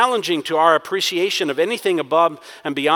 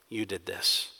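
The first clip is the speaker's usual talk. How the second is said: much softer, low, You leaned on; quiet blame; 9 semitones lower, 9 dB quieter than usual. Acted. People blaming God, quiet and low. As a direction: murmur the accusation under the breath. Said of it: "shifting the blame ... on God".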